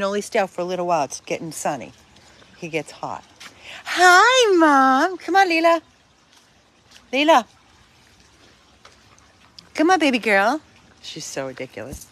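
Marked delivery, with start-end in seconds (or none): 3.8-10.6 cooing pet call. Real, not acted. Calling a dog in pet voice.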